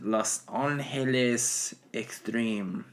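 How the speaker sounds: put-on slow Spanish-accented team announcement; mock Spanish accent. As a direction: say it drawn out with a Spanish pronunciation. Repeats the accented announcer name.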